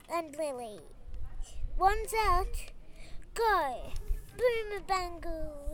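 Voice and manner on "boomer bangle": ordinary delivery, nothing marked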